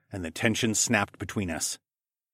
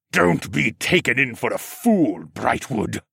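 snapped; 2 semitones higher, slower than usual. A narrator's reading. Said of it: "Siskorn snapped".

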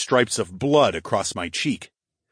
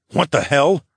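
a touch of a growl, raised; mild growl; 5 semitones higher, 5 dB louder than usual. A narrator's reading. Hero spots figures running from the scream, mild growl. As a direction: growl it lightly, a little louder. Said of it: "I growled".

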